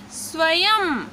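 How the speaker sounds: स्वयं spoken slowly with a clear final यम sound; slow word demo. Shown as a demonstration. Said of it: "हम क्या sound निकलेंगे". (स्वयं को धीरे, अंत में यम की ध्वनि के साथ)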